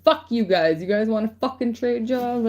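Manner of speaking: telling off the spirits in exasperation; irritated rant at the divine; mock angry challenge. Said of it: "sometimes I'm like".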